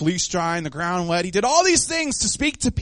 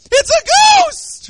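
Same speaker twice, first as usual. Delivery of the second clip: loud, squealing mock scream; high-pitched scared shriek; 10 dB louder, much slower than usual. Preacher acting disciples who see a ghost, terrified shriek. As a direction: shriek it way up high in comic terror. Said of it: "my voice goes high-pitched like that".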